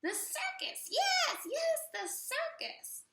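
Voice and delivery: very high, thrilled witch voice; giddy witch eureka; excited witch. Acted. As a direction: squeal with delight at the idea.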